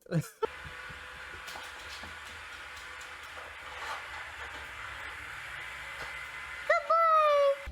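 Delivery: long, airy laughter; breathless silent laugh; wheezing laugh. Real, not acted. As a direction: wheeze through it. Laughing at a clip.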